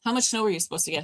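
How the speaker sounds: normal talking voice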